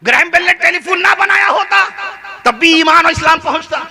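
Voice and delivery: ringing declaration. Shown as a demonstration. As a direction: proclaim Iman with force. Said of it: "repeated quietly after".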